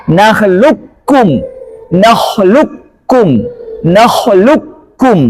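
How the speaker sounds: nakhluqukum read with the qaf fully merged into kaf, slow; idgham kamil reading. Shown as a demonstration. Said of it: "idgham kamil, dia bacanya".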